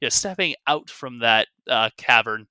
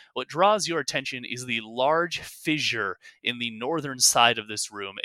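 ordinary talk, nothing marked

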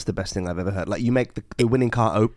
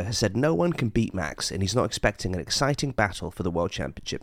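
usual speaking voice

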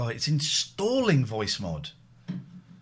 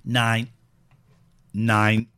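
shouted flat nine into the mic; loud robot voice attempt; 7 dB louder than usual. Shown as a demonstration. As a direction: bark the number for the voice changer. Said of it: "trying to do the voice yourself".

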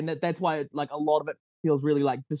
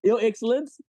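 put-on New Zealand accent; 6 semitones higher than usual. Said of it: "a New Zealand accent kicks in".

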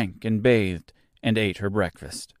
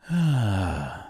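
long sigh of relief; satisfied sigh. A narrator's reading. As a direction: let out a slow, contented breath. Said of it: "gave a sigh of satisfaction".

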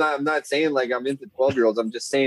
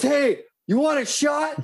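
much higher, slow mock party-guy offer; drunk buddy; slower than usual. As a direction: ham up the drunk friend leaning over. Buddy offering girls a shot.

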